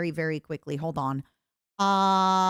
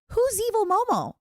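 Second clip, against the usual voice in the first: much higher, puzzled newbie voice; clueless new fan; 12 semitones higher than usual. Retold or imitated. Mimicking new fans who ask about Evil Momo, high and puzzled. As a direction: play the confused newcomer asking. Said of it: "other Momos who are gonna say".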